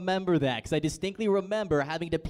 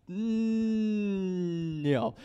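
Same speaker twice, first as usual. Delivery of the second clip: long-held higher hum, then a plain no; hammed-up hesitant "mm" before the no; reluctant verdict; 4 semitones higher than usual. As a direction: draw out a doubtful hum before turning it down. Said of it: "I'm like".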